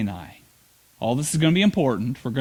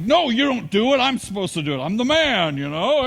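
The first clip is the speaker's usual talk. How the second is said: mock macho protest; 4 semitones higher than usual. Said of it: "I'm like".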